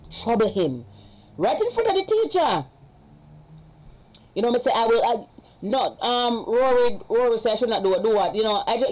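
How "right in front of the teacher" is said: indignant. Real, not acted. Indignant retelling of school confrontation.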